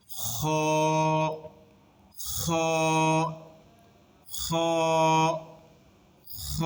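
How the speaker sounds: খ with a long rasping "kh" from the throat; throat friction kha. Shown as a demonstration. Teacher demonstrating the letter, খ with drawn-out friction. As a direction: scrape the back of the throat on খ, then hold the vowel. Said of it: "ঘর্ষণ খাবে".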